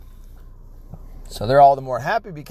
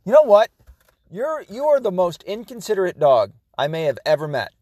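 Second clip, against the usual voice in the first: upset; 6 semitones higher than usual. Real, not acted. Owner telling his dog how inconsiderate it is, exasperated. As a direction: burst out, then settle into griping. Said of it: "Now I'm so upset".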